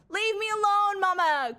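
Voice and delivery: high, loud imitated child's holler; toddler yelling. Mom quoting what her son yells instead of chase me, high mock yell. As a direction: yell it like a toddler, falling on the last word. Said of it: "he'll be yelling at in public".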